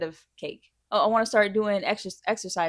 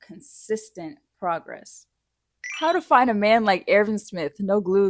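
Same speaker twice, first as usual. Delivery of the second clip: usual speaking voice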